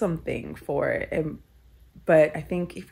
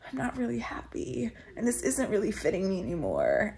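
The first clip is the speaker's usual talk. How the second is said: hushed whisper, barely voiced; whispered confession; 4 semitones higher, 8 dB quieter than usual. Confiding to her old self in a whisper. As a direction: breathe the words out in a whisper.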